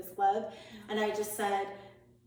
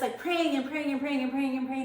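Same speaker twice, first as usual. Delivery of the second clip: ordinary delivery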